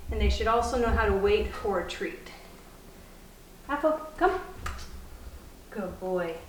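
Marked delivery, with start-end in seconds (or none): 3.7-4.4 higher-pitched recall to a puppy; calling a dog. Real, not acted. Trainer calls puppy from a short distance, high recall. 5.7-6.3 low, soft praise to a puppy. Real, not acted. Trainer to puppy who has come, soft praise.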